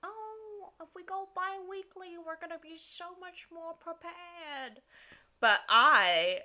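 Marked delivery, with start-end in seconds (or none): 0.0-4.8 very high, soft mocking voice; tiny, high sing-song of naive optimism; naive past selves. Host mocking past self's promise to prepare, naive voice. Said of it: "I laughed at past us saying".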